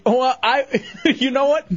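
laughing through the words. Real, not acted. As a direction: laugh as you start talking. Laughing at drive-thru joke.

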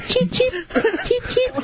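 high squeaky chick chirps; baby bird chirp. Pretend. Host mimics baby bird.

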